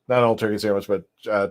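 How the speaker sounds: ordinary talk, nothing marked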